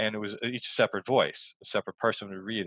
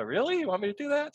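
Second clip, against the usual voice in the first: much higher, incredulous quoted question; mock disbelief. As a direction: act stunned she wants it serious. Retelling his disbelief to Susan.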